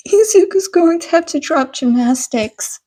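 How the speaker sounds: a mother fretting over her son's lessons; lightly voiced worried mother explaining. A narrator's reading. Inko voiced, upset.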